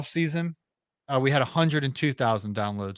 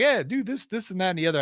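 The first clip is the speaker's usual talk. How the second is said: mock polished patter, jumping high at the start; slick company pitch; 6 semitones higher than usual. Guest mimicking a fake company that's got it all together, mock slick. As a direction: imitate a breezy know-it-all salesman.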